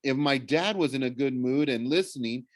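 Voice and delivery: plain reading voice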